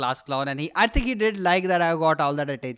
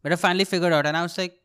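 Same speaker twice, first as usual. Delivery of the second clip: normal talking voice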